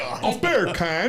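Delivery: slow, drawn-out British "A beer can?" with a laugh; mock English accent. Asked to say beer can, a slow laughing English accent. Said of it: "say beer can in the English accent".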